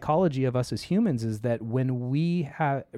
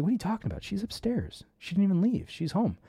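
higher, incredulous mock disbelief; confused husband; 8 semitones higher than usual. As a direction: toss it off like a baffled dad. Husband confused, daughter is upstairs.

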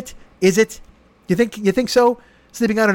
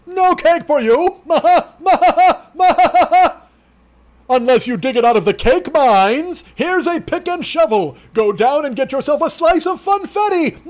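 much higher, louder mock rich man, cackling and shouting; Doctor Bombay voice; 9 semitones higher, 4 dB louder than usual. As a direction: lord it over them, gleefully denying cake. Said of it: "know Doctor Bombay".

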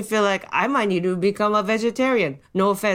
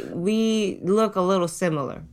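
ordinary delivery

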